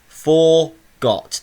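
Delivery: FORGOT broken into two loud beats, for, got; split into syllables. Shown as a demonstration. Teacher showing pupils the units of speech, split into syllables. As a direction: say "forgot" syllable by syllable, each one punched. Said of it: "they're my two single units of speech".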